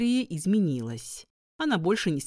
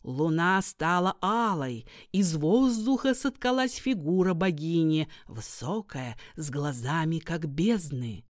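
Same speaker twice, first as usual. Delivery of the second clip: ordinary delivery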